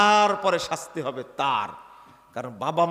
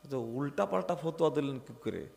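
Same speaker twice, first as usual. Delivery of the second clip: ordinary delivery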